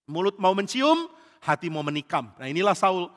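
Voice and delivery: ordinary delivery, nothing marked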